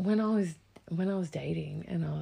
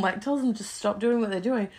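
ordinary talk, nothing marked

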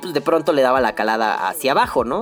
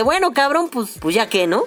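high-pitched, exaggerated reluctant giving in; grudging resignation; 7 semitones higher than usual. Wife giving in to her wary husband, grudging and high-pitched. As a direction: give in grudgingly, pitched well up. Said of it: "puso cara de".

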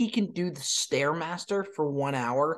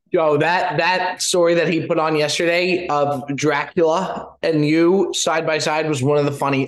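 loud, boomy far-off mic sound; talking through a bad headset mic, loud; in a tunnel; 7 dB louder than usual. Real, not acted. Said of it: "Bob, you sound like you're in a tunnel right now".

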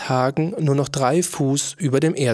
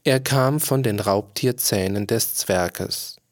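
ordinary delivery, nothing marked

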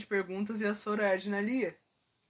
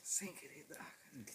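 whisper; 6 semitones lower, 16 dB quieter, slower than usual. Real, not acted. Playful teasing aside.

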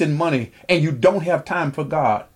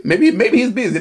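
mocking; 6 semitones higher, 3 dB louder than usual. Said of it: "Elijah mocked them and said".

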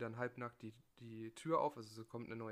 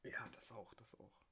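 whispering; 8 dB quieter than usual. Real, not acted. Whispered reply to the tease.

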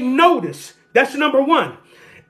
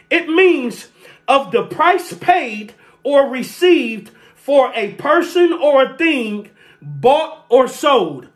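ordinary talk, nothing marked

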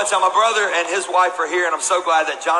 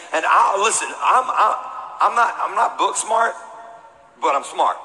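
normal talking voice

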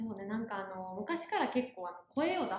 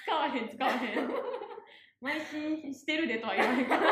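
louder, talking through real laughter; laughing admission; 3 semitones higher, 5 dB louder than usual. Real, not acted. Guest admits nobody says it in Kansai dialect, laughing. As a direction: crack up while protesting.

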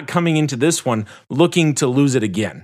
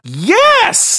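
very high, loud emphatic yell; exasperated shout; 6 dB louder than usual. Real, not acted. Host answering those who doubt Tony, exasperated shout. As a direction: explode with an obvious answer.

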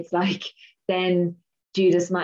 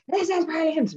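gleeful Jesus; 12 semitones higher than usual. Retold or imitated. He's loving it.

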